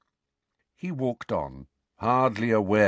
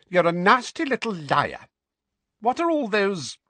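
much higher, spiteful sneer; nasty Aunt Petunia; 13 semitones higher, 5 dB louder than usual. A narrator's reading. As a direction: spit the insult at him. Petunia spitting accusation.

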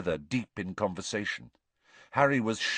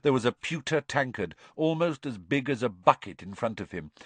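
usual speaking voice

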